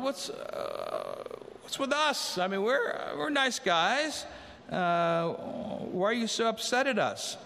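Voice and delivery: high, offended protesting voice; mock indignant protest. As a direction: play the condemned as wounded nice guys. The condemned protest to Jesus.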